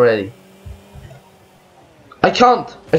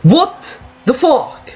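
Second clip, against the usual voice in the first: high, drawn-out disbelief; real outrage; 8 semitones higher, slower than usual. Real, not acted. Gamer hit by lag once more, outraged. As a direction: stretch it out in angry disbelief.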